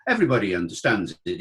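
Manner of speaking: ordinary talk, nothing marked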